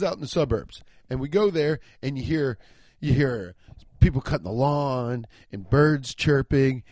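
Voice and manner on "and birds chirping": ordinary delivery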